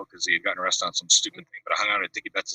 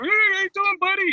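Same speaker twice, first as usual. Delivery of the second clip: much higher, mock rock-star greeting; imitating a rock singer; 4 dB louder than usual. Rock star walks into the house, greets him in a high mock voice. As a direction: pitch the greeting way up like a famous singer.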